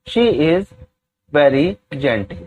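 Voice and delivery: each word spaced out slowly for students to copy; very slow, deliberate classroom dictation. Shown as a demonstration. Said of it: "teacher dictates English sentence".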